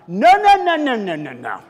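loud high panicky no no; hammed-up scream fading down; panicked yells. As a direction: yell in mock panic, then fade. Boy panicking at peroxide.

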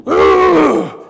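high, very loud frenzied "ugh"; mock berserk outburst; Tasmanian devil roar. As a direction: let out a wild cartoon tantrum yell. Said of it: "just go Tasmanian devil".

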